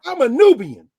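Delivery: slow, high, loud proud claim; proud declaration. Retold or imitated. Big brother proudly announcing himself to the speaker. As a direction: announce it slowly with pride. Repeats the proud self-naming.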